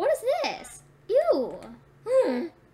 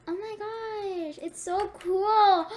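awed; 5 semitones higher, 4 dB louder, much slower than usual. Real, not acted. Awed first look inside zoo.

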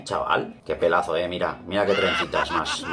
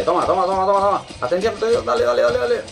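high, loud gamer cheer; excited cheering; 12 semitones higher, 4 dB louder, faster than usual. Real, not acted. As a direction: cheer the win loudly. Cheering during a game fight.

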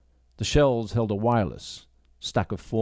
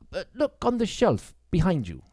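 put-on higher, hesitant young woman; young woman's voice; 10 semitones higher than usual. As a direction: bite back the questions politely. Grace suggests.

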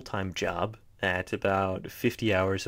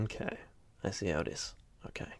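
mock offended aside; 10 dB quieter than usual.

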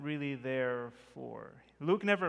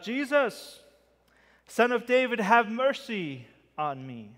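calling out; 7 semitones higher, 6 dB louder than usual. Acted. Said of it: "With more of an exclamation point".

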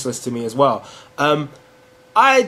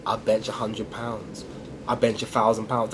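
low, hushed show-off voice; low-key bragging; 6 semitones lower, 8 dB quieter than usual. Acted. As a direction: brag softly and deep to impress a girl. Acted boast to a girl.

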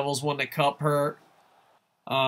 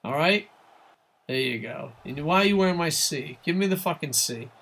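ordinary talk, nothing marked

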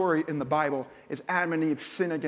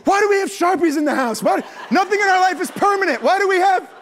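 high, loud frantic complaint; exasperated rant; 13 semitones higher, 8 dB louder than usual. Retold or imitated. Dad yelling at his wife about the Sharpies, frantic. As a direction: throw up your hands and yell it.

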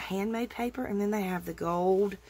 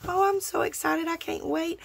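high and eager; giddy, can't-wait voice; excited; 7 semitones higher than usual. Real, not acted. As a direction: rush it out with glee.

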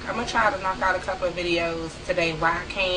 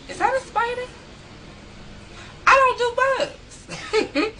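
much higher, slow, giggling "I don't do bugs"; laughing squeal. Real, not acted. Vlogger squirming at a bug question, high laughing squeal.

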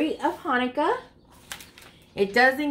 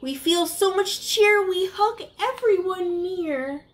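put-on reading to children; 5 semitones higher than usual. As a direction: read it like a bedtime picture book for little ones. Reads rhyming picture book aloud.

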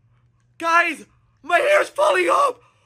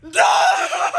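shocked shout. Pretend. Boy seeing his eyebrows dyed pink, shrieking. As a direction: shriek the no in horror.

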